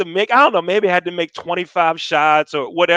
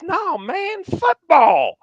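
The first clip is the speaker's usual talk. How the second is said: high, incredulous "no, man"; exasperated correction; 12 semitones higher, much slower than usual. Retold or imitated. Guy corrects the lost new student, exasperated. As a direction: push it up high as if it were obvious.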